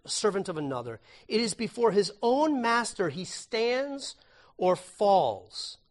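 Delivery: emphatic reading of scripture; solemn scripture reading. Shown as a demonstration. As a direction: read the verses with weight. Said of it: "Romans fourteen".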